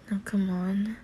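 normal talking voice

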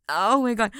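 ordinary talk, nothing marked

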